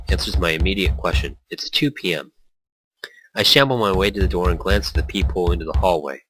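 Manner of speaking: usual speaking voice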